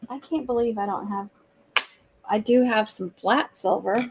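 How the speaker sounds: usual speaking voice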